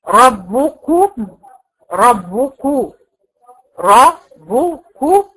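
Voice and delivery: ربكم said very slowly, each damma rounded fully; teacher demonstrates completing the vowels in ربكم, slowly; slow recitation. Shown as a demonstration. تلاوة بطيئة مع إتمام الضمة. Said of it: "هو بيديكي مثال هنا".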